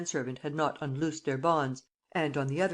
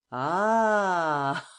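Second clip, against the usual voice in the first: loud burst of laughter; shouting with laughter; 4 dB louder than usual. A narrator's reading. Lupin laughing at the trapped Kesselbach, loud laughter. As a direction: cry out, then laugh hard at him. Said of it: "cried Lupin, shouting with laughter".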